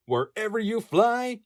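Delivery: plain reading voice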